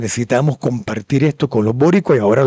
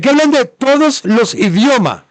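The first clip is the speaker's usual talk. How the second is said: heboh; 11 semitones higher, 4 dB louder than usual. Real, not acted. Excited build-up to the reveal.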